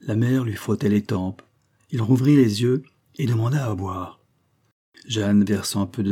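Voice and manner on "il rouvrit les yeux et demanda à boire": ordinary delivery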